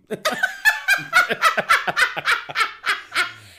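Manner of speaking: loud high burst of laughter; long shrill laugh; high cackle. Real, not acted. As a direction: cackle at her own joke. After her joke.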